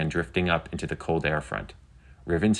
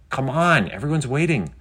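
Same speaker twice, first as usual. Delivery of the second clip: higher, playful urging; coaxing; 8 semitones higher than usual. A narrator's reading. Penny urges Riven on before waiting viewers, coaxing. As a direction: lightly pester a shy friend.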